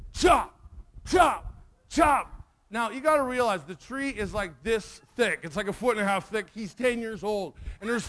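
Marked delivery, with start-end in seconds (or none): 0.1-2.3 loud, punchy separate bursts; imitated axe blows. Bully starts on the tree with his axe, loud imitated blows. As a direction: punch each word like a blade hitting wood.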